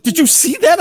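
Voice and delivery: high-pitched, wowed question; acted amazement. Wife to husband after the meteor, acted amazement. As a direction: imitate someone thrilled by what they just saw.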